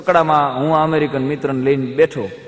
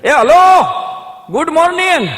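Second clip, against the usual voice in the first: loud, high yelled hello good morning; shouted greeting; 13 semitones higher, 6 dB louder, slower than usual. Retold or imitated. Villager shouting his newly learnt English greeting across the street. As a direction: holler it across the street, proud of the new words. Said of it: "ચમને રાડ પાડી".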